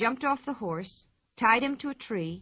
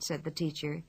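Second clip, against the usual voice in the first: usual speaking voice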